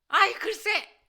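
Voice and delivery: high, loud burst of irritation; re-enacted exasperated outburst; angry retort. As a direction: cut mom off crossly. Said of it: "이러면서 얼마나 화를 냈는지".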